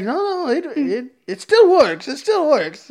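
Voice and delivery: put-on very high, loud Italian accent; flustered cartoon Italian accent, breaking into laughter. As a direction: protest in an exaggerated Italian accent. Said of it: "He was, like, Italian".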